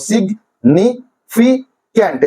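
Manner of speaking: Significant as সিগ, নি, ফি, can't; syllable by syllable. Shown as a demonstration. Teacher helping students memorise spelling, significant in slow separate syllables. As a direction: slowly split significant into four beats with gaps. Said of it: "সহজে spelling গুলো মুখস্থ করতে পারবে".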